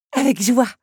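put-on young woman eagerly agreeing to help; enthusiastic. Belle eagerly accepts to help the teapot. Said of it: "s'enthousiasma la jeune femme".

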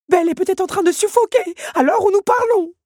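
high, loud alarmist voice; dramatic clock. A narrator's reading. Big Ben scaring the others, high and loud. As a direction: paint the worst case.

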